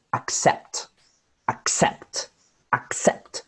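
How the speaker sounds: "accept" with a light "ac" and strong "CEPT"; "ac-CEPT", first syllable weaker; second-syllable stress. Shown as a demonstration. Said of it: "where the stress of the syllable goes".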